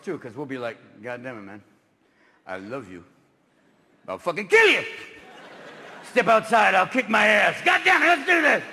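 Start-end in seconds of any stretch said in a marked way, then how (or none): 1.0-3.0 low, much softer, maudlin; sappy drunk. Acted. Moody drunk telling his buddy he loves him, soft. 4.1-8.6 loud, rising to a high shout; belligerent drunk. Acted. Moody drunk challenging his buddy outside, shouting.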